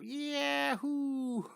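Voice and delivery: playful high-pitched yahoo; gleeful whoop. Acted. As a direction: whoop lightly for a flying cartoon girl. Voicing drawn girl having a blast.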